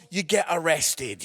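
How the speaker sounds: ordinary talk, nothing marked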